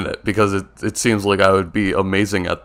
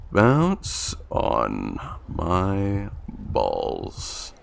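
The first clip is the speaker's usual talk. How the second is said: extremely slow, stretched-out words, sinking low; soft, dragging read-as-you-type; distracted typing; 4 semitones lower, 6 dB quieter, much slower than usual. Real, not acted. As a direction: draw each word out as you type it. Typing the search term slowly.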